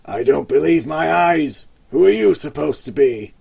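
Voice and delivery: loud, disbelieving boss voice; incredulous grunt. Acted. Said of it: "grunted the gang leader".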